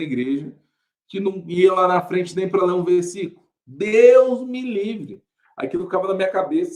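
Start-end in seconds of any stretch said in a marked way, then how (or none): 3.7-5.2 loud, high, long-drawn exclamation; emphatic horror. Retold or imitated. Shy boy refusing to go up front, loud drawn-out horror. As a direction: stretch it out high in mock horror.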